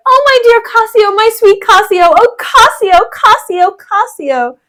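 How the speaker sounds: high, loud melodramatic lament, falling away at the end; Bianca. Acted. Bianca crying over wounded Cassio, high overwrought wailing. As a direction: wail for a lover in a hammed-up high voice. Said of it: "And then Bianca says".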